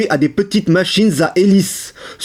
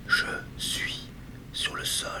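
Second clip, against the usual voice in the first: hushed, voiceless whisper; 19 dB quieter than usual. Acted. Speaker confiding where they are on the floor, whispered. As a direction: whisper it as a private aside.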